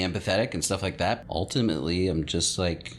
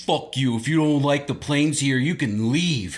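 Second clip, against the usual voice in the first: higher, louder scornful neighbor reply; mock hostile neighbor; 7 semitones higher, 5 dB louder than usual. Neighbors telling him to leave, scornful mock. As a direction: sneer it like a smug local.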